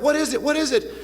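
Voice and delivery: put-on a startled girlfriend just woken up; woman waking alarmed. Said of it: "the girl would wake up".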